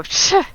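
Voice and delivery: sharp high scoffing psh; contemptuous scoff; loud scoff. Acted. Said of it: "she's just going to loudly scoff".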